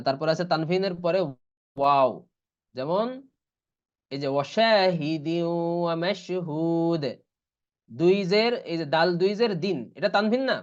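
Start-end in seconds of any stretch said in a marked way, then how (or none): 4.4-7.0 slow, drawn-out Quranic recitation; ওয়াশাহিদি glided into ওয়া through a held nasal w; idgham with ghunnah. Shown as a demonstration. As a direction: recite ওয়াশাহিদি ওয়া মাশহুদ with long sustained vowels. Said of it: "ইদগাম করে পড়তে হয়".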